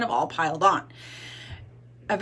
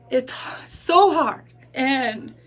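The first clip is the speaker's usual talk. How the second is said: slow, higher voice on the edge of tears; fighting back real tears; tearful, with effort; choked up; 4 semitones higher, 4 dB louder, much slower than usual. Real, not acted. Breaks down recounting screaming at kids.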